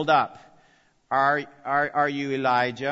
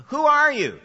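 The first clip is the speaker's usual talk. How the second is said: pressing question; 12 semitones higher, 7 dB louder than usual. Acted. Priests questioning John, voiced.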